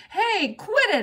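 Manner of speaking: high, loud indignant yell; sharp childish outburst. A narrator's reading. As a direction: yell at them to stop. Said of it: "I yelled".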